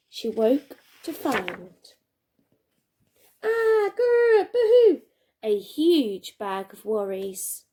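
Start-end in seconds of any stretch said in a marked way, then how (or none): 3.4-4.5 a sudden high growl; a monster-ish grr. Acted. Teacher reading aloud to pupils, a playful high growl. Worry bag appears, growling. 4.5-5.0 high, loud mock boo-hoo sob. Reading to children, a loud playful pretend sob. Mock crying sound in story.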